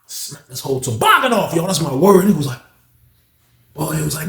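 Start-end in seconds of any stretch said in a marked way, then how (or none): none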